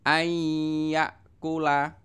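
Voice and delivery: ayyakula very slow, nn merged into a hummed y; teacher demonstrating idgham; nasal merging. Shown as a demonstration. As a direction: hum the merge into ayyakula slowly. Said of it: "bacaan idgham bi gunnah".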